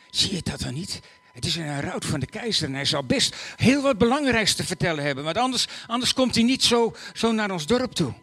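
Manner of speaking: put-on a village boy pressing the news; insistent boy. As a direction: urge him to see it. Boy nudges Joseph, insists.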